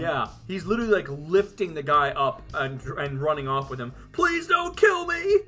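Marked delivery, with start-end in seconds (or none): 4.1-5.4 high, slow pleading cry; terrified horror victim. Acted. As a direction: beg for your life.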